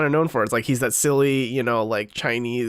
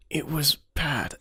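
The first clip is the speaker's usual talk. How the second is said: whispered aside; 5 dB quieter, slower than usual. Real, not acted. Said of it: "I can't do an Irish accent".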